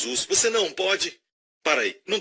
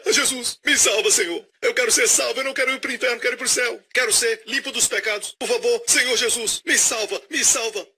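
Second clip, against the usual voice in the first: mock begging prayer; 7 semitones higher than usual. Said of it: "Como você tem orado?".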